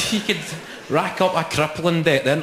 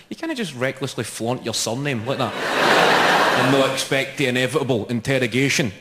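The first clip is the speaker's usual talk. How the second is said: ordinary delivery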